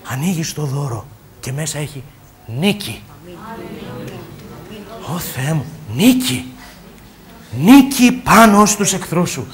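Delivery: soft, low, intimate proclamation of victory; hushed preaching. Acted. Preacher softly telling the congregation of victory, hushed and intimate. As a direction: draw them in quietly, almost confiding.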